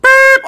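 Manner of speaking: high electronic beep; smoke detector beep. Acted. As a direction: beep like an alarm. Person acting as smoke detector.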